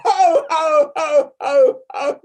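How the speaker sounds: very high loud ho, ho with real laughter; ho laughs breaking into laughter. Shown as a demonstration. Teacher cracking up while leading ho, ho. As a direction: let the ho tip into a laugh. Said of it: "And one more".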